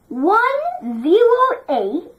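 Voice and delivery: slow, careful digits for the class; slow teacher demo; slow model reading. Shown as a demonstration. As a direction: spell out each number slowly. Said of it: "teacher models for class".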